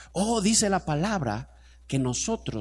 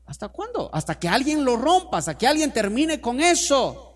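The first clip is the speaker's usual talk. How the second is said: rising preaching shout; 8 semitones higher, 5 dB louder than usual. Real, not acted. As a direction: climb high and loud into the cry. Preacher builds to climax. (predicación a gritos)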